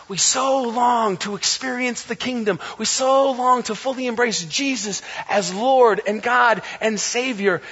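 ordinary talk

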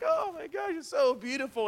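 high, gushing awe. Retold or imitated. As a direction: swoon over the view. Friend admiring the hike view.

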